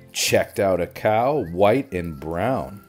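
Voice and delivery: plain reading voice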